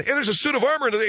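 high, fast museum-goer; mock excitement. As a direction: rattle it off like a gawking visitor. Mimics museum visitor spotting armor.

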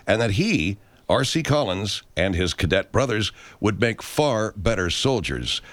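podcast announcer. Acted. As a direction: announce the episode like a host. Podcast intro read.

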